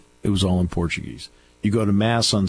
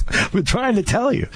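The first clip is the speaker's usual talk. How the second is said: much higher, told-you-so tone; mock exasperated; 14 semitones higher than usual. Speaker retelling his reply to his colleague. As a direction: pitch it up, finally vindicated. Vindicated in retold story.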